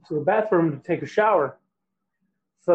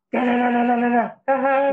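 higher sing-song teasing giggle; mock giggle; 6 semitones higher, faster than usual. A high taunting giggle behind the bathroom door. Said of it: "and giggling".

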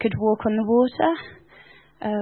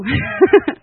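high delighted laugh; 9 semitones higher, 6 dB louder than usual. Real, not acted. Delighted by journal.

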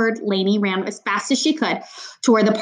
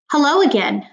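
higher-pitched, coolly pointed greeting; a touch of ice, pitched up; cold greeting; 6 semitones higher, 4 dB louder than usual. A narrator's reading. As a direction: greet an enemy with mild coldness. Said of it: "Mister Today said coldly".

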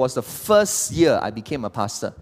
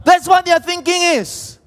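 high, loud, scoffing squeal; mocking exclamation; 8 dB louder than usual. Pastor mocks the Western view of teenagers, high and loud. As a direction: throw it up mockingly.

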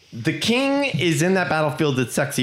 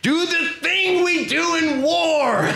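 mock furious Gul'dan; 13 semitones higher, much slower than usual. Gul'dan demanding the kill, high mock fury. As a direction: shriek the order like a villain. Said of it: "Gul'dan is like".

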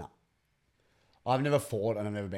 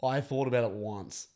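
ordinary talk

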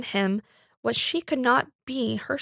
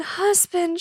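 crying out higher; 7 semitones higher than usual. A narrator's reading. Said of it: "she cried".

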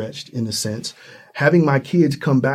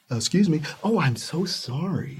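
mock apology; 3 semitones higher, 6 dB quieter than usual. Mimicking apologetic shopper.

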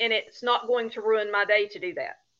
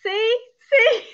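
high, loud laughing squeal; 11 semitones higher, 7 dB louder than usual. Real, not acted. Laughing at herself.

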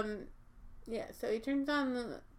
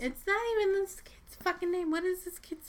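high, loud exasperated outburst; 8 semitones higher, 4 dB louder than usual. Real, not acted. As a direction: throw your hands up at the name. Drunk friends riffing.

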